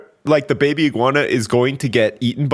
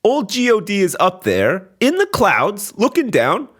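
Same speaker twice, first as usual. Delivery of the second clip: ordinary talk, nothing marked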